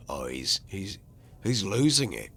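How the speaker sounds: normal talking voice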